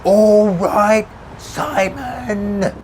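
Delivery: high yell, name drawn out; yelling back. A narrator's reading. Tommy repeating greeting to narrator over din, yelling. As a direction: yell the greeting across traffic. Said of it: "he yelled back".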